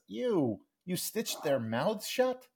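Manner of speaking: high, slow, appalled question; acted mother's grossed-out disbelief; disgusted reaction. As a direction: draw it out in mock horror.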